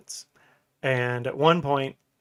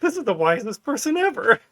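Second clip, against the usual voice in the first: mock awe; 9 semitones higher, 6 dB louder than usual. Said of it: "I was like".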